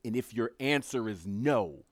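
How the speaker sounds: usual speaking voice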